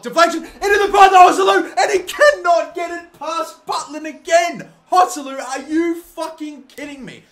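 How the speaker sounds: loud, high-pitched match commentary outburst; exasperated shout. Real, not acted. Commentator raging at Joselu for failing to beat Butland, exasperated shout. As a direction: yell in real disbelief at the miss.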